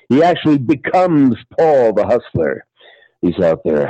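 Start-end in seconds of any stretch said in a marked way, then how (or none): none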